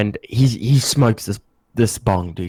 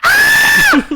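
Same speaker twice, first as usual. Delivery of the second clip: huge high scream; startling scream; 7 dB louder than usual. Retold or imitated. Shrieked scream. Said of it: "scream as loud as you can".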